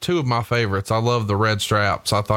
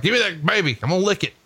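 high-pitched mock wrestler demand; lightly put-on eager wrestler, pitched up; wrestler's gimmick; 8 semitones higher, faster than usual. Wrestler to a fan at ringside, playfully demanding. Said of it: "he's just like".

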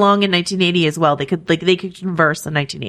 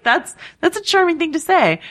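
much higher, gushing mock delight; mock charmed gush; 10 semitones higher than usual. Co-host imitating her own charmed reaction, high mock gush. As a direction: swoon over it, hammed-up. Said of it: "And I was just like".

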